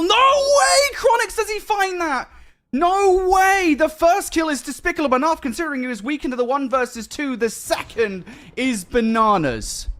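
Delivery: shouting high and loud in disbelief; excited shouting. Real, not acted. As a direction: yell it out, stunned by the play. Caster erupts at clutch kills.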